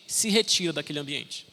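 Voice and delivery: ordinary delivery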